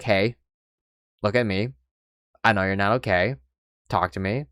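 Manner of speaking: low, soft, with patient pauses; gentle coaxing, imitated. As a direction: coax someone upset into opening up. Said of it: "kneels down to me and she's like".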